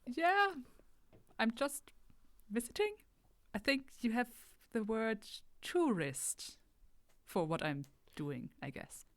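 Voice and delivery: hesitant visitor searching for the word; tentative alien visitor. Acted. Player voices timid alien answering.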